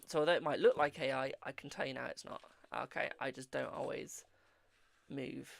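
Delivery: usual speaking voice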